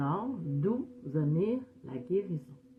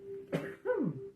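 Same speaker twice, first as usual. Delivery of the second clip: a real sneeze; sneezing; 7 semitones higher, 4 dB quieter than usual. Real, not acted. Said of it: "je vais éternuer".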